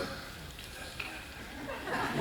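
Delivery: slow, barely voiced whisper; secret whisper. Actor retelling co-star's covert cue on set, slow whisper. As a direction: whisper it under your breath.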